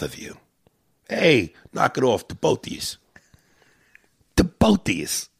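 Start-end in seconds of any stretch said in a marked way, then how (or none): 1.1-3.0 Italian-American accent. Shown as a demonstration. Host showing the Italian way, New York Italian accent. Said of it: "the proper way to say it". 4.4-5.3 The both of yous, pitched up, New York Italian; Italian-American accent. Shown as a demonstration. Host repeating the Italian phrase, New York Italian accent. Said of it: "the proper way to say it".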